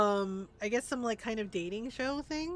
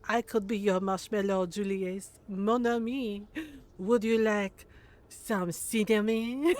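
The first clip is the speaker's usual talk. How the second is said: flirty mock French accent, louder; 5 dB louder than usual. French mom teasing Julius with sweets, flirty French accent. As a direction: ham up a French seductress teasing him. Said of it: "Is it because she's French?".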